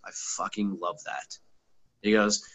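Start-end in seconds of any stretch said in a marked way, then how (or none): 0.0-1.4 imitating a hushed, slow impressed guest. The guest reacting to the long drive, hushed. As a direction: say it soft and slow, quietly impressed.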